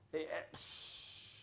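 breathy scoffing eh eh psh; dismissive scoff. Retold or imitated. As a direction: scoff it off under your breath.

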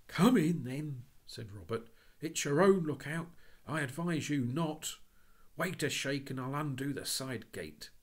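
high, loud exasperated warning; boy exasperated, warning the policeman; agitated. Acted. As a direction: give in with a shout. Robert giving up to policeman.

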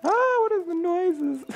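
high, slow, frightened cry at the noise. Real, not acted. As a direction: squeal it out, spooked. Spooked by noises in game.

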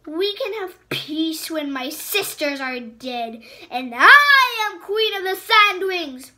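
high, loud furious queen; furious outburst. Acted. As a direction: rage at him, cut him off. Queen's defiant rant.

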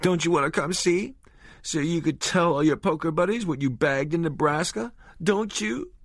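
sobbing, taunting. A narrator's reading. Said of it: "lost in fresh sobs".

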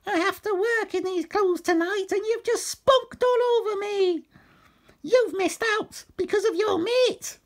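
very high falsetto rant; huffy woman character. A narrator's reading. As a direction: complain indignantly in a put-on woman's voice. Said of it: "she stormed off in a huff".

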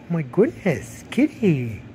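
usual speaking voice

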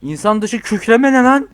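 high, slow startled cry; alarmed by a roar in the game. Real, not acted. Startled player asks about the roar.

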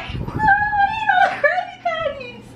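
high shouting cartoon squid; Squidward voice. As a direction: yell it as a whiny cartoon. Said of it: "And he was like".

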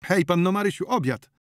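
high, loud dinner call; calling from outside. A narrator's reading. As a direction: call her in through a window. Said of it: "rozległ się za oknem głos".

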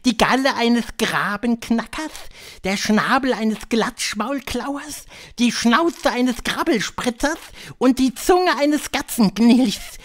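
high, loud witch voice; high-pitched, theatrical witch leader; Grand High Witch. A narrator's reading. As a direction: lecture the coven in a high, imperious voice.

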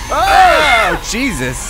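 high, loud cry of shock; startled cry. Real, not acted. Game player jolted by something actually scary, startled cry. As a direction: yelp as if jumped by something.

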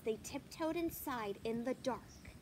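ordinary delivery, nothing marked

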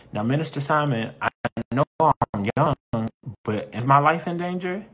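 acted worried kid asking the minister; worried young listener. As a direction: put on a youngster's voice, mildly alarmed. Voices a young listener's worried question.

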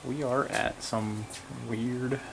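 talking quietly. Real, not acted. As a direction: keep it down, don't get caught filming. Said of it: "I'm talking kinda quiet".

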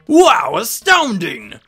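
loud, high burst of astonishment; amazed professor. Acted. Professor seeing the magical map reveal itself, loud astonishment. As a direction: exclaim it big, utterly astounded.

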